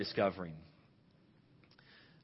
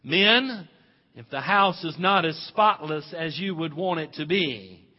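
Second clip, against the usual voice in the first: loud, high preacher's call; rally the whole church; preaching at full force; 6 semitones higher, 14 dB louder than usual. Real, not acted. Preaching to the congregation.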